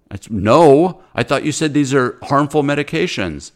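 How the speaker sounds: retold indignant burst, loud and high; exasperated retort. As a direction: imitate yourself pouncing on the contradiction. Doctor retells his exasperated comeback.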